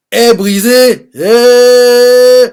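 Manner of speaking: sudden shouted exclamation; loud outburst. Real, not acted. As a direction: burst out over the broken dagger. Outburst mid-retelling.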